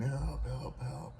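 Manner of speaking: half-voiced high screaming; mild mock scream. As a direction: imitate someone screaming from down a hole. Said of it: "keeps screaming".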